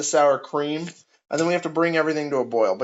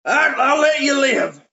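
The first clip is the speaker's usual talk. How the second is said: put-on slow, high, loud old farmer; hillbilly farmer; 9 semitones higher, 5 dB louder, slower than usual. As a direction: holler it slowly like a hillbilly. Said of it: "this old farmer hillbilly man".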